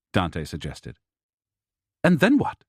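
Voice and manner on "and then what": curt. A narrator's reading. Wife pacing the kitchen answers her husband curtly. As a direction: cut him off impatiently. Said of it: "Elena asked curtly".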